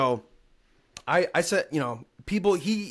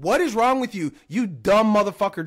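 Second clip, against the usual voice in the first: higher and louder, furious and incredulous; angry outburst; 6 semitones higher, 6 dB louder than usual. Real, not acted. Podcaster raging back at the coach, higher and louder. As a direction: let him have it, fed up. Ranting at coach's insulting message.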